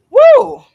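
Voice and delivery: loud, high "woo"; big whoop. Real, not acted. Woman recalling reaching out to her ex, loud whoop. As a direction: let out a sudden high whoop. Exclaims before hard reveal.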